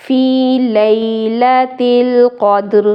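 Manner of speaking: slow فی لیلۃ القدر with a clear final ra; tajweed demo. Shown as a demonstration. Teacher models the ending for students. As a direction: end القدر on an audible ra. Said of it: "آخر میں را کی آواز آئے گی".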